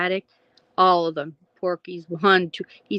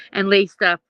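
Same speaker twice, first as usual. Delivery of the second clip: ordinary delivery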